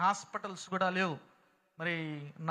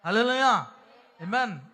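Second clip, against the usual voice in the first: exultant hallelujah; 3 semitones higher, 10 dB louder than usual. Real, not acted. Preacher rallying the congregation, exultant. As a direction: lift the whole room.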